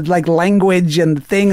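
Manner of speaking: ordinary delivery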